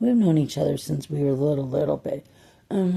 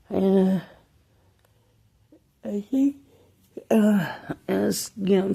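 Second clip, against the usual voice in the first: teary-eyed; 4 semitones higher, 3 dB louder than usual. Real, not acted. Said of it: "I kinda got a little teary-eyed".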